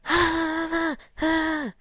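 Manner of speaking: put-on high, whining kid's cry of frustration; upset kid whine. Child complaining to a parent, high frustrated whine. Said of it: "one kid is fed up and they're like".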